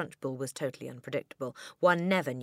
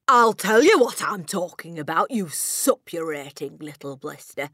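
shouting; 3 semitones higher, 12 dB louder than usual. A narrator's reading. Headmistress rounding on the puzzled boy, shouting. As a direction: shout it back with contempt. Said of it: "the Trunchbull shouted".

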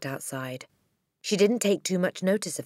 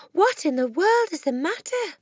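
high, raised question; startled concern; 12 semitones higher, 5 dB louder than usual. Acted. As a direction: ask in astonished concern. Teacher startled by running child.

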